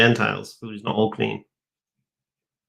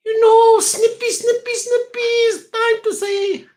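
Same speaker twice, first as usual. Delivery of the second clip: very high, squeaky, hammed-up menace, slowing down at the end; slower than usual. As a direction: squeal it out like a comic villain.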